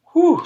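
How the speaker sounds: high, loud relieved sigh of a word; loud high relief; relieved whew. Acted. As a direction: let the tension out. Relief after danger.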